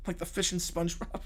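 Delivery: ordinary talk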